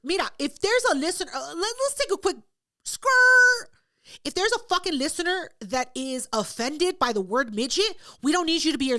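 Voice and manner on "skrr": high loud skidding screech; tire screech noise. Retold or imitated. Host imitating screeching tires before a rant. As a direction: mimic a car braking hard. Mimics a quick brake sound.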